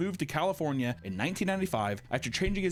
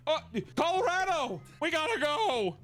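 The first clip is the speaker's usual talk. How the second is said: much higher, eager mock follower voice; excited followers; 13 semitones higher than usual. Host mocking followers rushing to relocate, high eager voice. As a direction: squeal it like a gullible believer. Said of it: "They're like".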